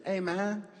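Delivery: casual kid greeting, mimicked; six-year-old boy. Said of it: "the six-year-old".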